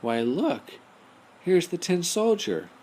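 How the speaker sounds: a sudden delighted discovery; surprised voice. Acted. Cook finding the soldier in the fish, surprised. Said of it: "He heard a voice say".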